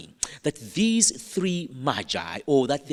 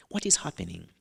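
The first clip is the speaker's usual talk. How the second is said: usual speaking voice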